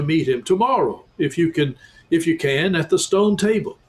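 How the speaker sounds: high, hearty beaver voice; a beaver welcoming guests; talking beaver. A narrator's reading. Beaver telling Susan why he brought them, high and hearty.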